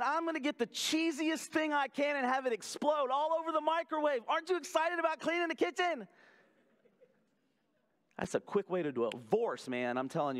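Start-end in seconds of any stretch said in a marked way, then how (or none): 4.3-6.1 high, fast, teasing sing-song; husband cheerfully taunting his wife about the mess; mock cheerful question. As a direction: sell the chore like a treat. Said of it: "me saying to my wife".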